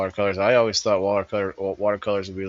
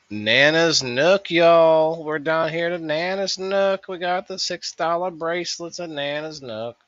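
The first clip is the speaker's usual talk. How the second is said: put-on Nana accent; 9 semitones higher, 4 dB louder than usual. Vlogger hawks Nana's craft booth in a mock country accent. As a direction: sell the booth like a sing-song country auntie. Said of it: "I don't know why Nana had a, had an accent".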